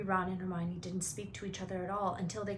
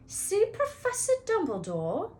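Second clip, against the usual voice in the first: much higher, incredulous repeat; suspicious echo; 12 semitones higher, 6 dB louder than usual. Acted. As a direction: echo it as if it's fishy. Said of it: "as though this was a very fishy thing".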